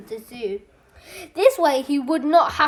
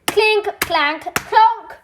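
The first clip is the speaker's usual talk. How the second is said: clanking tin legs; 7 semitones higher, 4 dB louder, much slower than usual. A narrator's reading.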